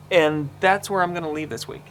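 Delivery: ordinary talk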